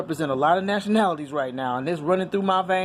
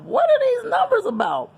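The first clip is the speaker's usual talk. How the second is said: sudden high-pitched wondering, louder; puzzled question; 5 dB louder than usual. Retold or imitated. Telling viewers how she puzzled over the numbers, high astonished squeak. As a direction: squeak it out in amazement.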